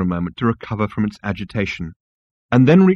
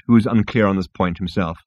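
plain reading voice

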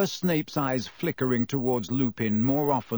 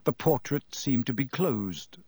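ordinary delivery

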